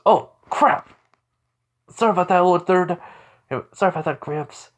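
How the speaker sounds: high, loud startled apology; alarmed apology. Acted. Accidentally freezing Gramps with ice, alarmed apology. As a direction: blurt it out high, easing down by the end.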